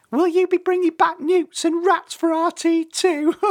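squeaky, very high, loud bird voice; teeny chick ending in giggles; joking tiny chick. A narrator's reading. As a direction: crack the joke in a baby-bird squeal. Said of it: "joked the tiny chick".

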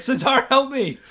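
loud, high retold cry for help; comic desperate pleading; desperate plea. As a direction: beg a friend in hammed-up panic.

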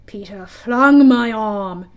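slow and loud, with a wince; wincing. A narrator's reading. Hook recalling his lost arm, wincingly. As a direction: draw it out as a painful memory. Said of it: "he said wincingly".

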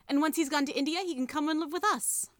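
lightly voiced excited little girl; eager child. A narrator's reading. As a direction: lift the pitch up high with hopeful excitement. Polly's eager plan, read aloud.

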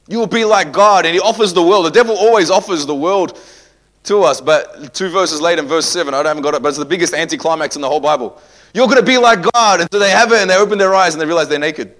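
high, loud preacher's build-up; fiery preaching. Real, not acted. Pastor preaching to the church with rising fire.

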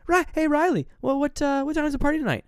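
very high, put-on mother voice; mock prying mom. Mother slyly questioning her son.

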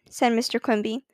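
plain reading voice

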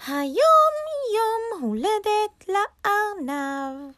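very high, stretched-out rabbit voice; writing aloud. A narrator's reading. Rabbit writes his birthday sign, slow and high. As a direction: draw each word out slowly, high.

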